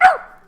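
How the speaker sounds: high, loud, sudden yelp; dog's pained yelp. Retold or imitated. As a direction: imitate a dog getting stung. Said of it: "he gets stung and he's like".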